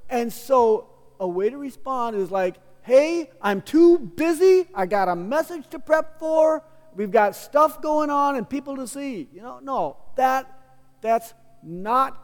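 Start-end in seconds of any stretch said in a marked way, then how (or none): 2.8-9.3 higher, louder, self-important excuse; acted impatient brush-off, pitched up; busy brush-off. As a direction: brush them off as too busy. Acted unkind brush-off example.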